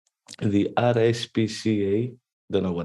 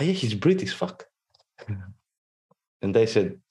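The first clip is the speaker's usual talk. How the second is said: ordinary delivery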